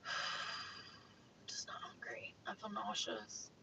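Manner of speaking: whispered, queasy confession; barely voiced, feeling sick; soft nauseous murmur; quiet. Real, not acted.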